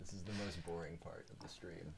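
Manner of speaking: whispered aside. Real, not acted. Streamer whispering about the boring setup part. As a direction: whisper it like a confession to the chat.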